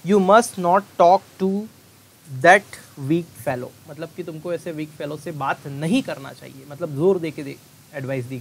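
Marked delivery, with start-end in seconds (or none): 0.1-3.7 very slow, loud example read; slow dictation. Shown as a demonstration. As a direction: read it out slowly to the class. Teacher reads example sentence.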